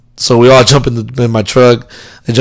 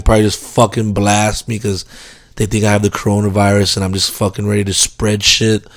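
ordinary delivery

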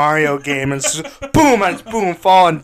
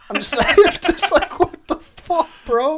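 high, laughing what the fuck; laughing disbelief; 13 semitones higher, 5 dB louder, slower than usual. Retold or imitated. Retelling dying in the fire, laughing in disbelief. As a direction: squeal it through laughter. Retold in-game exasperation.

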